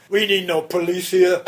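put-on lightly done German accent, laughing. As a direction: imitate a big German bouncer. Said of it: "in a heavy German accent".

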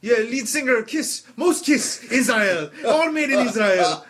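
high mock-Israeli accent; Israeli salesman. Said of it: "he is not native to this country".

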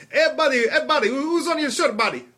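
high, loud mock-Israeli accent; Israeli salesman. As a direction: pester like an Israeli mall salesman. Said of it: "there was some Israeli dude".

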